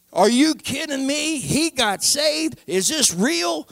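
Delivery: high, drawn-out incredulous questions; retold disbelief. Family hearing he got saved, mock-incredulous. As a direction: stretch it out, unable to believe the news.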